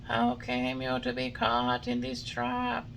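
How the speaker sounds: high, slow glowworm asking with pity. A narrator's reading.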